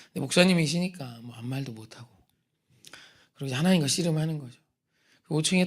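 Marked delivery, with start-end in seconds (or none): none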